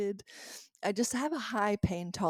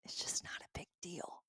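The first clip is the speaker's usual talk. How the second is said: whispered reassurance; 3 semitones lower, 16 dB quieter than usual. Real, not acted. Looking back on the day, whispering to herself that it was no big deal. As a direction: whisper it to calm yourself down.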